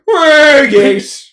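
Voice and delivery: high, loud mock-British monster voice; British Godzilla. Godzilla hailing everyone, loud high British accent. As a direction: cry it out in comic British. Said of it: "he's British".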